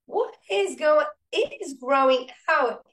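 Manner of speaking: sudden high, loud cry of wonder; retold gasp of astonishment; amazed outburst. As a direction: blurt it out in disbelief. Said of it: "and I'm like".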